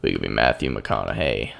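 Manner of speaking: put-on deep rumbling voice with a little southern accent; Matthew McConaughey. Gamer trying a celebrity impression, low southern rumble. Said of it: "put a little southern accent on that".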